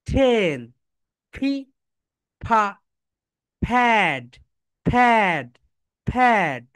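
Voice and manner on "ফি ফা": usual speaking voice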